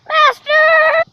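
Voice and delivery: loud, high drawn-out cry; calling out. Pretend. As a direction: call desperately for your master. Calling out to the master.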